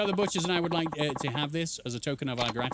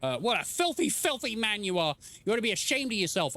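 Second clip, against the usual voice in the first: put-on higher scolding old lady voice; mild higher voice of an indignant market lady; 6 semitones higher than usual. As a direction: scold him like an outraged stallholder. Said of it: "is that the, uh, the market lady?".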